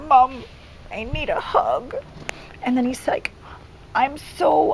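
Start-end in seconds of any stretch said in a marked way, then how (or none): 0.1-1.9 imitating an upset child, pitch swooping; a higher, mock-tearful child's plea; retold tearful plea. Upset son asks mom for a hug, retold tearful plea. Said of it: "huge meltdown and started crying". 3.9-4.7 higher, halting imitation of an upset child; retold tearful confession. Crying son confides in mom, retold tearful confession. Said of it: "started crying, and then he's like".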